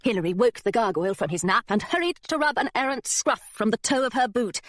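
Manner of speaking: usual speaking voice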